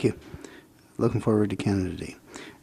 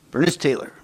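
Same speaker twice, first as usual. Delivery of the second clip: slightly raised radio-announcer call; 4 semitones higher than usual. Real, not acted. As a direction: announce the name like a radio host. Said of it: "That's like your radio announcer voice".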